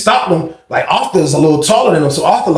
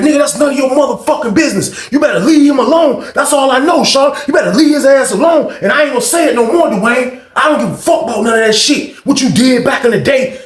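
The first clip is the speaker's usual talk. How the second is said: mock threatening yell; 10 semitones higher than usual. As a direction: warn him off hard. [name] threatens Dwayne.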